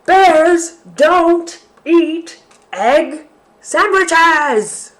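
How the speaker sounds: final bear refrain. Acted. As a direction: stretch it out in disgust. Bear's final disgusted refrain.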